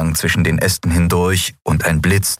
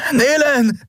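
calling out; slower than usual. Acted. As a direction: shout their names across the forest. Calling out for lost friends.

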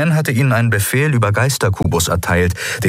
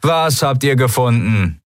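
irritated call, pitched up; annoyed shout; 6 semitones higher than usual. A narrator's reading. Pirate answering the shouting from below, annoyed call. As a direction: call back as if bothered. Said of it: "rief jemand genervt".

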